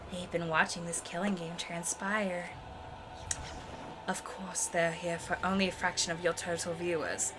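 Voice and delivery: low, very soft, even delivery; calm game character. Acted. Reading game dialogue about the watching audience, low and very soft.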